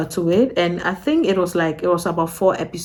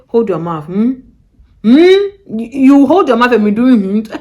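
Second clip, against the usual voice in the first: mock stern order; 4 semitones higher, 9 dB louder than usual. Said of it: "they'll say".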